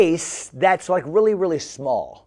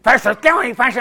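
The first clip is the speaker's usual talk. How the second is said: put-on higher, louder pestering kid; Bobby; 6 semitones higher, 6 dB louder than usual. Camper badgering his pastor, higher goofy voice. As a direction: mimic a nagging, goofy boy. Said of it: "He said".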